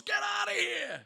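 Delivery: high, loud imitated shout; yelling cop. Cop sending the driver off, yelling. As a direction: yell him away like an officer. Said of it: "he's t-, yelling, screaming at them".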